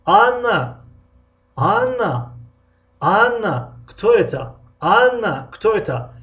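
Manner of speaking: Анна drawn out high and loud at the start, then falls; stretched first word. Shown as a demonstration. Teacher showing students the second construction, stretched start. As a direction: stretch the opening Анна and drop the end.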